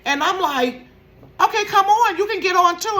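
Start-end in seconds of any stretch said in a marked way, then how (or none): 1.4-3.0 bright coaxing call. Retold or imitated. Telling Tracy to climb on.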